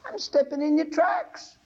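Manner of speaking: put-on high, slow child's voice; little boy voice. Preacher voicing the little boy to his drunk father, high child voice. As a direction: sound like a small boy answering his dad. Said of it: "He said".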